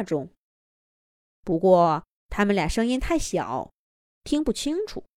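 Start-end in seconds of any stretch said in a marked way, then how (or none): none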